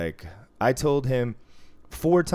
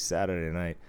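ordinary talk

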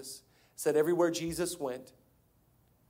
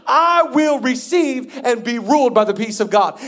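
loud, high, emphatic declaration; preached confession; 7 semitones higher, 10 dB louder than usual. Real, not acted. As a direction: proclaim it for the congregation to claim. Leads second confession.

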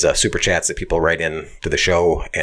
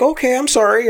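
high, hurried sorry; flustered apology; 4 dB louder than usual. Retold or imitated. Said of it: "She's like".

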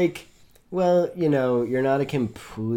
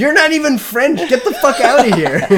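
much higher, louder hammed-up outrage; mock angry local; 12 semitones higher, 11 dB louder than usual. Friend plays an outraged Frenchman, high and loud. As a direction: squawk it like an offended local.

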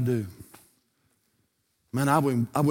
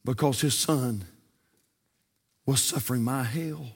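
pastoral prayer. Acted. Closing prayer and altar call.

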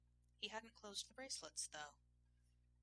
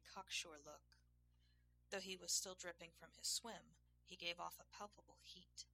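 ordinary delivery

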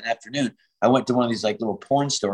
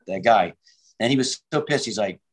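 ordinary talk

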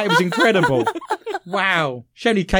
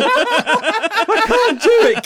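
high, slow words through laughter; laughing; 7 dB louder, much slower than usual. Real, not acted. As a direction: crack up mid-sentence. Laughing during the shout.

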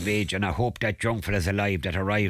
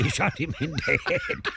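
laughing; 6 semitones higher than usual. Real, not acted. Punchline laughed out.

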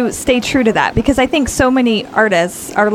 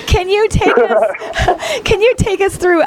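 laughing; 9 semitones higher, slower than usual. Real, not acted. Interviewer laughing at hiccups.

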